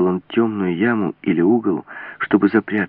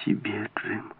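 slow, whispered entreaty; faint, imploring breath; pleading; 4 semitones higher, 8 dB quieter, slower than usual. A narrator's reading. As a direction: beg him in a drawn-out whisper. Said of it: "молила она".

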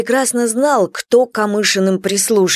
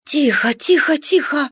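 put-on hushing a scared little boy; soothing a child; 6 semitones higher than usual. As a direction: gently hush the child. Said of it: "успокаивала Марфа сына".

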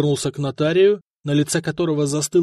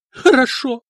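despairing; 9 semitones higher, 6 dB louder than usual. A narrator's reading. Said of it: "совершенно отчаявшись".

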